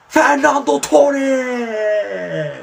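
a long, very high shouted name; ecstatic goal shout. Real, not acted. Celebrating his own goal, a slow, soaring shouted name. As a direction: roar it like a commentator after a goal.

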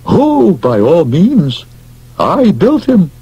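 lightly voiced inventor; high slow proud reply. Stillman proudly tells the doctor he built him. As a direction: savour the reveal.

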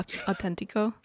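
softly said mock Spanish accent; horrible Spanish accent. Shown as a demonstration. Host explaining the joke to co-host, horrible Spanish accent. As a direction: show off your bad Spanish accent. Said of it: "making fun of my horrible Spanish accent".

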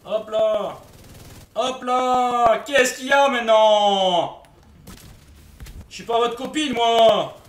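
loud, slow, drawn-out shouted taunt; mock angry shout. Streamer yelling at swarming enemies, loud and slow. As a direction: bellow at the mob swarming you.

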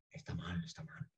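hushed whisper, nearly no voice; secretive whispered "está mal"; whispered gossip. Retold or imitated. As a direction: lean in and whisper it.

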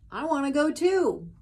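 high, loud little dog voice; pleading pup wanting to come; eager dog. Retold or imitated. Retelling the dog asking the witch to come along.

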